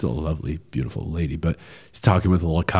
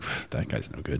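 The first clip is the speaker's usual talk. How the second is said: a scoffing under-the-breath put-down, much softer; dismissive aside; 4 semitones lower, 14 dB quieter than usual. Retold or imitated. Audience dismissing a bad actor.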